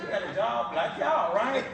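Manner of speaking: laughing taunt. Retold or imitated. Retold taunt to coworkers.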